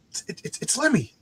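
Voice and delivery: high, hushed, stammering awe; starstruck stammer. Retold or imitated. Teen fan to his friend as Lemmy appears, hushed awe. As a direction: gasp it softly, tripping over the words.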